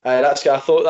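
usual speaking voice

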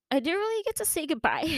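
high voice cracking with real crying; breaking into tears. Real, not acted. Podcaster recalling pet led away without a goodbye, breaking into tears. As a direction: let the sentence dissolve into tears. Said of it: "my first time crying".

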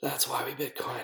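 breathy whispered tagline. Real, not acted. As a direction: whisper it like a secret motto. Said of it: "I'm being real corny and cheesy here".

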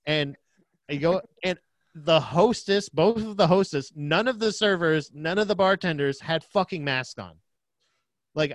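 ordinary talk, nothing marked

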